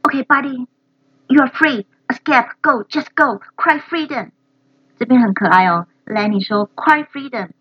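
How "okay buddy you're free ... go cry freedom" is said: higher, urging release; imitating Lenny. Learner echoing Lenny freeing the worm, higher and urgent. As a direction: shoo him off to freedom. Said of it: "copies Lenny clip".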